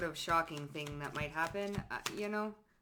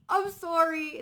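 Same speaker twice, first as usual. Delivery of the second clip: high, louder mock apology; sing-song insincere sorry; fake sorry; 9 semitones higher, 6 dB louder, slower than usual. Mocking someone caught doing drugs, high fake sorry.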